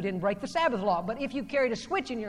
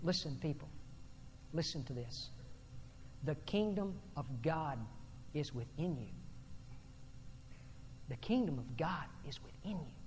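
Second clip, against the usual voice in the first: low, soft, solemn appeal; quiet pulpit emphasis with pauses between phrases; hushed preaching; 8 semitones lower, 14 dB quieter than usual. Real, not acted. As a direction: drop your voice and let each phrase sink in. Preacher drops voice for key point.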